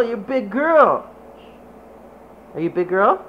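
ordinary talk

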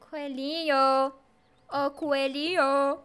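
slow, sing-out call. Pretend. Streamer looks for a hidden bunny, calling out slowly. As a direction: draw the last syllable out as a call.

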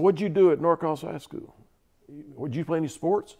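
lightly imitated Southern officer, pitched high; strong Southern accent. Commander asking about high school, Southern accent. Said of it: "in a real strong Southern accent".

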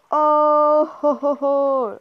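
loud, high, stretched-out whoop; excited whooping. Real, not acted. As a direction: whoop it out slowly and loudly. Reacts to a close game moment.

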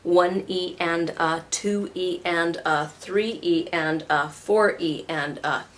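slow, even sixteenth count; "one E and a" counted slowly, every syllable separate. Shown as a demonstration. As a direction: spell out each "E and a" slowly. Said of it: "I'll say that slower".

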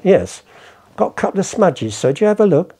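deferential seller. Acted. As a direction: play the humble seller addressing sir. Acts deferential seller at auction room.

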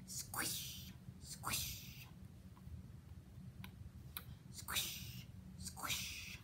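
soft breathy whisper of squelching steps; whispered squish. Acted. Reader whispers the mud steps to the children. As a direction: whisper it like feet sinking in mud. Walking through mud sound.

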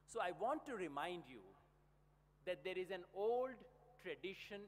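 slow, deliberate, word by word; measured lecture-hall emphasis; slow formal oratory. Acted. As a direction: lay each word down for the audience. Slow emphatic English passage in speech.

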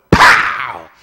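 explosive, drawn-out POW; sudden booming exclamation; loud impact shout. Acted. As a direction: punch it out like a blow landing. Acting out a blow.

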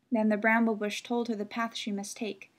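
usual speaking voice

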